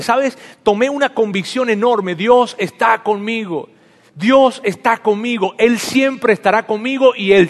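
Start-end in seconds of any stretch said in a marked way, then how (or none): 2.2-5.5 slow, each word weighed, louder; fervent conviction. Real, not acted. Telling the congregation God is with him, fervently. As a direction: declare it firmly and slowly. Sermon climax, repeated conviction.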